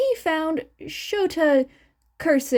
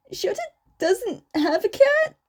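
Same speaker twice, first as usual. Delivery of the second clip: higher, baffled question; puzzled surprise; 9 semitones higher than usual. Acted. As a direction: sound caught off guard. Confused father.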